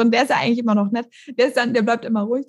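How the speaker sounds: usual speaking voice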